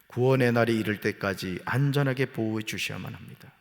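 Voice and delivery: usual speaking voice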